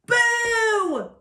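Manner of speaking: high, loud, drawn-out scare; startling mouse shout; shouted boo. A narrator's reading. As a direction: pounce with a long loud "boo". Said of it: "shouted a little gray mouse".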